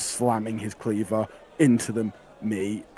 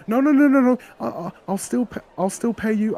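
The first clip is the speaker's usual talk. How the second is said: high, loud rushed protest; frantic boy; 14 semitones higher, 6 dB louder than usual. Acted. GM as the weedy lad bursting out to stop the deal, frantic high voice. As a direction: blurt it out in a panic, much higher and louder. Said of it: "he quickly comes out and goes".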